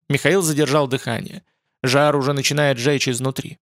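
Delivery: ordinary delivery, nothing marked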